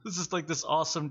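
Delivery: usual speaking voice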